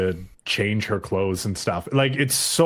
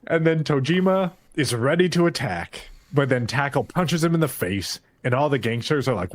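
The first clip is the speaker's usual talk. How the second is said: ordinary delivery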